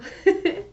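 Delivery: short high giggle; high laugh. Real, not acted. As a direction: giggle lightly. Laughs at cowbell joke.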